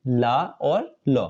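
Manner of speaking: ला long, then ल short, slowly; slow contrast of ला and ल. Shown as a demonstration. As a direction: stretch ला, then clip ल. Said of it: "ल में आ की मात्रा लगाते हैं".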